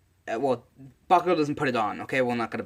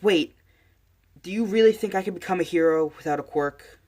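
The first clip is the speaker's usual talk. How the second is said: urgent plea; 6 semitones higher, 4 dB louder than usual. Acted. Boy calls departing hero back.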